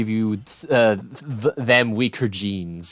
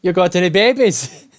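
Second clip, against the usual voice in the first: mock character voice; 6 semitones higher, 4 dB louder than usual. Repeating the funny clip line.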